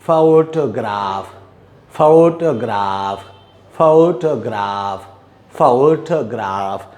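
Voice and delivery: photograph very slow and low, FOH-tuh-grahf; low, stretched photograph with a long final ah; model pronunciation. Shown as a demonstration. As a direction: draw out photograph syllable by syllable. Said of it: "The pronunciation is".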